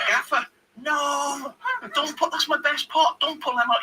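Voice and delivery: high-pitched wail with a long drawn-out "no"; mock alarmed protest. Host pleading with his gaffer over the flowers, mock alarmed. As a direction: act out a panicked plea, high.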